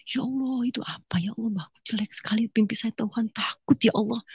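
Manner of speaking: soft, half-whispered scared prayer; frightened whispered prayer. Retold or imitated. Woman praying at dawn after a nightmare, afraid. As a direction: pray in a hushed, trembling hush. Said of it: "Saya ketakutan".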